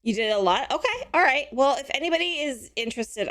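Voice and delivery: ordinary delivery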